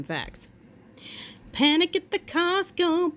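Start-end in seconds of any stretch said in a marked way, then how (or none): 1.5-3.2 dramatic title. Acted. Announcing segment title dramatically.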